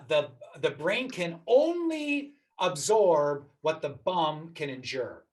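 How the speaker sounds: slow, deliberate quote delivery; slow quoting voice. Retold or imitated. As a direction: savour each word of the quote. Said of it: "one of my favorite quotes is".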